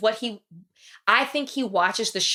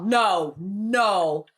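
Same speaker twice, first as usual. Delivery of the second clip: usual speaking voice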